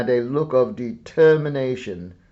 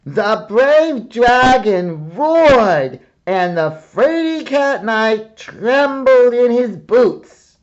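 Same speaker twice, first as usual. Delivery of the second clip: loud, high storyteller boom; 11 semitones higher, 11 dB louder than usual. Acted. Boy reads aloud to the hidden dragon, booming. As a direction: read it big to lure the dragon. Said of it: "read aloud in a booming voice".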